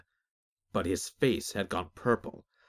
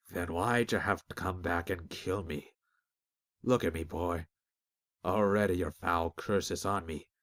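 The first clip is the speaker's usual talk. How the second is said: weak dying man; 3 semitones lower than usual. Acted. Dying Peg accuses, weak.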